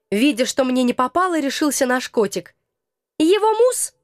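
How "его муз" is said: squeaky high cat question. Acted. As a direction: ask in a high kitty voice. Cat asks.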